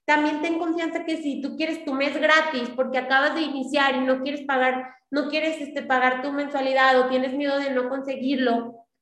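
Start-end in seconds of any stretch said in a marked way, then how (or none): none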